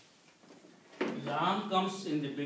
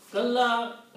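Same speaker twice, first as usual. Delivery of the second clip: kalla said high and loud; emphatic kalla; 7 semitones higher, 7 dB louder than usual. Shown as a demonstration. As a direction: punch out "kalla" for the class. Teacher stresses Arabic word.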